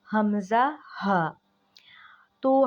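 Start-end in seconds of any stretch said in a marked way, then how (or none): 0.1-1.4 हमज़ा spoken, then हा only breathed; lower-throat letters. Shown as a demonstration. Teacher naming hamza and ha, ha whispered. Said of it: "जो letters पढ़े जाते हैं वो हैं".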